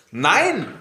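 ordinary delivery

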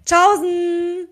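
"Tschaußen!" called out high and loud, playful; silly goodbye. Shown as a demonstration. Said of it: "voll komisch Tschaußen gesagt". ("Tschaußen!" laut und albern gerufen)

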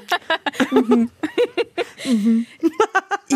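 sustained burst of laughing; real laughter. Real, not acted. Podcast opens on laughter.

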